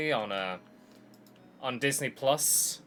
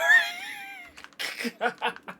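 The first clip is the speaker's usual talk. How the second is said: high laugh; 3 dB louder than usual. Real, not acted. Still laughing at the music.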